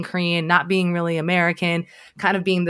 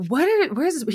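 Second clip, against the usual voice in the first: high, bewildered "where is this"; genuinely thrown, pitch jumps up; baffled surprise; 8 semitones higher, faster than usual. Retold or imitated. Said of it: "I'm like".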